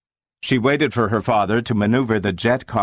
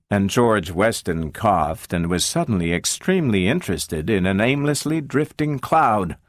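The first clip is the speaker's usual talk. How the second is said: usual speaking voice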